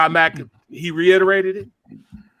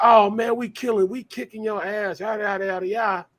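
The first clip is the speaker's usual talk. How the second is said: retold trash talk; 5 semitones higher than usual.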